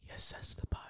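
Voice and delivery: hushed, voiceless whisper. Real, not acted. Kid whispers about the movie to parent, whispering. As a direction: whisper it very low. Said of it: "Stop whispering".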